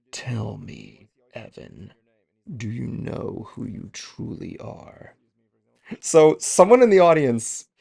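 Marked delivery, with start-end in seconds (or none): 0.1-5.1 hushed, low villain voice, drawn out slowly; slow, soft and deep, like an ominous game villain; Xemnas. Acted. Voice actor quoting his villain for a fan, low hushed menace. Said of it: "asks him to do the Xemnas boy, voice".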